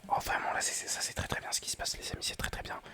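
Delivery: excited whisper pushed loud; a whisper that keeps rising with excitement; thrilled gamer whispering too hard. Real, not acted. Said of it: "je chuchote peut-être un petit peu fort".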